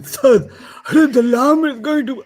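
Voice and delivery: high, hammed-up tearful wail; mock sobbing complaint to the teacher; voice of the ummah. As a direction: blubber like a helpless student. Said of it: "That's the voice of the ummah in my head".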